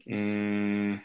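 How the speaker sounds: usual speaking voice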